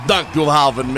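loud put-on Jamaican patois. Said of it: "going full Shaggy mode".

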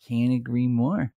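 slow, lips pushed out, odd voice; lip-smacking impression. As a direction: mimic his weird lip thing. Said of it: "He goes".

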